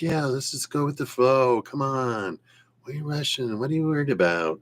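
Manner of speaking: a hint of a chilled-out surfer saying what's the rush; surfer dude. Reader voicing Keen as a surfer dude, mellow and light. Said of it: "like, a surfer dude".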